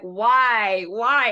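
loud, incredulous repeated why; exasperated why. Retold or imitated. Friend reenacting her outrage at the show, loud and incredulous. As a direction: push back hard on the question.

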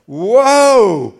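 loud, slow whoa of wonder; amazed sinner. Retold or imitated. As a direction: gasp at the news. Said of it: "They say".

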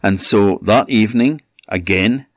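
ordinary delivery

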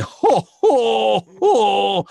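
high, loud puppet laugh "jo, jo, jo"; Alf's laugh. Retold or imitated. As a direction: belt out a high alien laugh. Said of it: "la risa que tenía era de". (risa aguda y fuerte de Alf)

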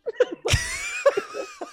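very high screaming laugh; shrieking laugh. Real, not acted.

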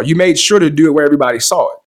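ordinary delivery

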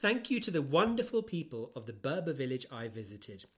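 ordinary delivery

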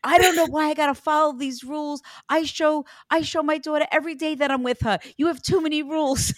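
high, loud mock-annoyed dad; annoyed father. Medium voicing the father's annoyance at rules, mock-grumbling. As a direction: gripe like a fed-up father, hammed up. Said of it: "He's so annoyed with me".

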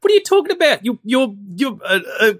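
very high, incredulous mocking rant; mock exasperation. Co-host ridiculing the Venom symbiote's line, in high-pitched mock exasperation. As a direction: splutter it in a high, squeaky, disbelieving voice. Acting out mockery of film line.

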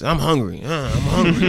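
imitated hungry whine; mock whining. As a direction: whine it like an impatient complainer. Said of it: "he's, like, whining".

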